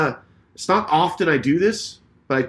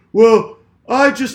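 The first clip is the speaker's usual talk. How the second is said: high, loud, drawn-out stammered excuse; mocked other driver; 9 semitones higher, 9 dB louder, slower than usual. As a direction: imitate him starting a defence.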